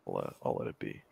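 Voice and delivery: unvoiced muttered aside; whispered. Real, not acted. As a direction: whisper it under breath.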